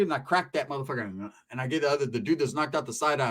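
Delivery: normal talking voice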